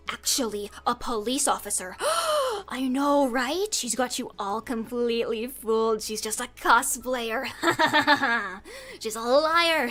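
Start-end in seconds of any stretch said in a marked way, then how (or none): 2.0-2.6 sharp high gasp of fake shock; mock gasp. High mock gasp for the viewers. 7.5-8.9 laugh. Real, not acted. Laughs at own joke.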